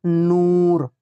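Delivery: नूर with a long, stretched oo; drawn-out vowel. Shown as a demonstration. Teacher showing Urdu learners a long waw, नूर drawn out. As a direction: draw out the oo in नूर. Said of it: "खूब खींच कर पढ़ा जाए".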